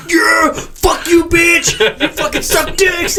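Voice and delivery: high, slow hammed-up yell of swears; comic high-pitched outburst, dragged out; mock tic yelling. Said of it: "this guy's like".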